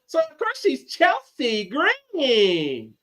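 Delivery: a hint of a ring announcer calling a Valley girl's name. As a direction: lightly mimic a ring announcer's Valley girl call. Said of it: "Chelsea Green is a Valley girl".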